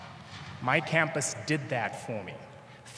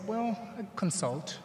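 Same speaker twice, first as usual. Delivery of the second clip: "Well, I consult." drawn out slowly and pitched higher; coy understatement; 8 semitones higher than usual. Retold or imitated. Telling the Uber driver his job, slow and higher. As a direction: play it coy, stretching every word slowly.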